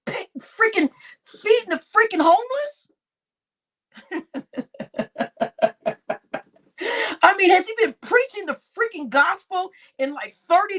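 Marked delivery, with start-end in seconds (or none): 4.1-6.4 breathy laugh. Real, not acted. Laugh after a rant.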